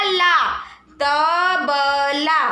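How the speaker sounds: ला with a long aa, तबला slowly stretched; drawn-out pronunciation demo. Shown as a demonstration. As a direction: teach it slowly, holding the vowel of "ला". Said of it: "तो pronounce होगा". ("ला" और "तबला" को खींचकर धीरे-धीरे बोलना)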